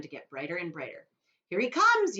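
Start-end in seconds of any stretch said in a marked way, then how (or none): 1.5-2.2 high, loud excited yell. Acted. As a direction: call it out. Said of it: "yelled Mister Wonka".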